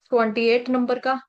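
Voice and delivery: ordinary talk, nothing marked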